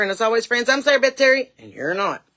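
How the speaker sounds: high, loud catchphrase; loud sign-off. Real, not acted. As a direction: announce your name proudly. Signature sign-off.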